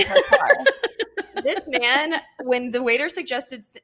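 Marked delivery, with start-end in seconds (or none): 0.0-2.2 laughing through the quote, higher and louder; can't get the line out without cracking up. Real, not acted. Retelling the waiter's answer to her date, laughing.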